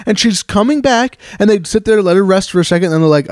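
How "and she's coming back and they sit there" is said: ordinary delivery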